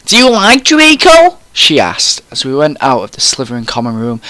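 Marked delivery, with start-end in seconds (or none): 0.1-1.4 high, loud gushing question; excited prying. A narrator's reading. Friend asking about a crush, excited. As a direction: squeal the question, pitched way up.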